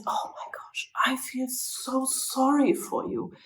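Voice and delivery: soft, half-whispered sorrow; hushed, imitating being close to tears; retold tearful sympathy. Psychologist to dying patient she grew fond of, softly tearful. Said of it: "I got so teary-eyed".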